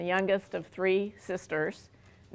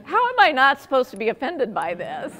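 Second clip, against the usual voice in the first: mock offended protest, higher and louder; indignant retort; 7 semitones higher, 5 dB louder than usual. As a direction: play up being hurt, half joking. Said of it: "I'm just".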